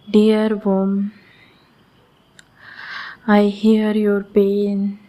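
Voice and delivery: ordinary talk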